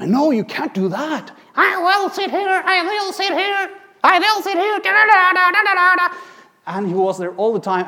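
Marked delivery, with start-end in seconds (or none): 0.0-1.3 raised, higher telling-off; quarreling. Acted. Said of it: "I was quarreling with the". 1.5-6.2 very high, stubborn squeaky voice; demanding teddy bear puppet. Acted. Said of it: "the teddy bear demanded to sit".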